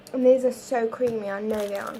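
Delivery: ordinary talk, nothing marked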